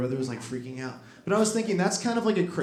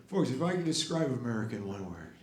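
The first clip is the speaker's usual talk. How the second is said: soft, quick folksy repetition; Biden impression; 6 dB quieter, faster than usual. Biden describing America, softly rambling. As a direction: mumble it twice like losing the thread. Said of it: "he's like".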